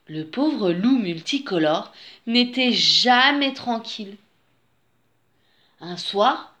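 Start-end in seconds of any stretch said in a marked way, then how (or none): none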